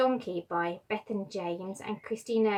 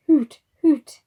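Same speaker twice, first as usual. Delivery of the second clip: higher, louder owl hoot; owl call; 5 semitones higher, 13 dB louder than usual. Acted.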